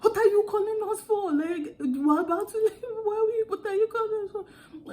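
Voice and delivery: put-on high, loud, fast exasperated African accent. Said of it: "I started speaking African".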